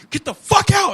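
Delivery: loud, high, furious yell of get the fuck out; steaming shout. Retold or imitated. Friend imitating his playback, a furious loud yell. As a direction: bark out the fuck and out with full force. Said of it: "you could feel the fucking steam".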